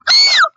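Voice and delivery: a sudden very high shriek. Real, not acted. Reacting to the toy, a sudden high shriek. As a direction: shriek out in surprise.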